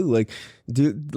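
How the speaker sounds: ordinary delivery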